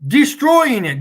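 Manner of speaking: DESTROYING punched high and loud; emphatic repeat. Real, not acted. Streamer taunting globe believers, emphatic and loud. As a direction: hit "Destroying" hard and high, then fall.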